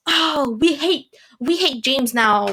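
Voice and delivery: imitating a whiny angry mob; put-on, hammed-up crowd indignation; mock outrage. Vlogger mimicking fans turning on James, mock outrage.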